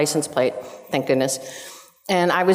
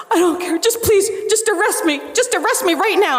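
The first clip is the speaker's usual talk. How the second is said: imitating sobbing plea; 13 semitones higher than usual. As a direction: wail it like a desperate driver begging. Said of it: "I just started crying".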